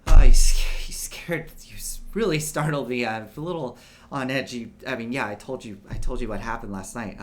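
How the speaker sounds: a lightly voiced jumpy young man, breathless whispered start; shaken, catching his breath after a fright; nervous Anders, startled.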